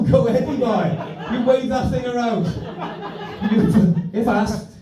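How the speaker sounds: high, slow, laughing cheer; laughing mock encouragement. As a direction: cheer him on through laughter.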